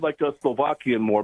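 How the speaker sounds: normal talking voice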